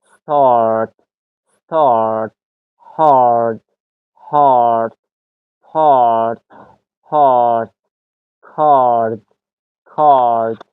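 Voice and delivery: each word said separately, "استارت" with a drawn-out "ah"; long "ah" demo. Shown as a demonstration. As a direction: model "هارد" and "کارد" with a long open vowel. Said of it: "ساوند آ".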